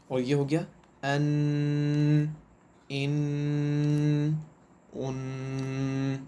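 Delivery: ان held long with the sound humming through the nose; nasal ghunna demo. Shown as a demonstration. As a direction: stretch ان and hide the voice in the nose. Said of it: "ان دونوں کو پڑھتے ہیں".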